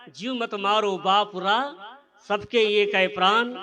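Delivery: chanted doha of Kabir, slow and firm; recited couplet. Shown as a demonstration. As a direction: intone the Kabir verse slowly and solemnly. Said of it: "इसलिए कहा है".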